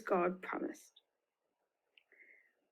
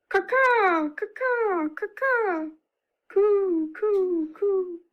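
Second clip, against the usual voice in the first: bird calls; 9 semitones higher, 3 dB louder, slower than usual. Shown as a demonstration. Birds sent out by Noah.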